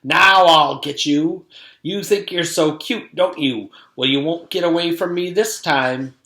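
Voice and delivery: loud menacing witch voice; villain threat. Acted. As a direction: threaten the kids.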